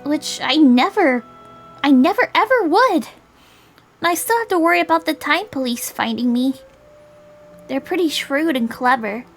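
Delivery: put-on high, insistent young character; earnest girl. Voices Julie in dialogue.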